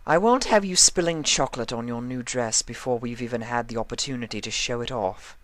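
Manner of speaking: prim fashionista scolding a fidgety friend; Rarity's voice. A narrator's reading.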